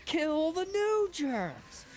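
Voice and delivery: high, loud ranting comic impression; Orny Adams rant. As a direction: yell it like a fed-up comic on stage. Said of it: "He's like".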